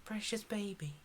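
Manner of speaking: high, soft coo fading to whisper. Real, not acted. Player cooing at a dog, high and hushed. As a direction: fuss softly over a precious pet.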